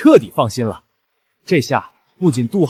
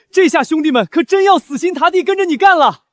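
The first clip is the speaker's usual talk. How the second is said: high-pitched, eager show of loyalty; excited pledge; 12 semitones higher than usual. Acted. Worker to generous boss promising triple wages, excited pledge.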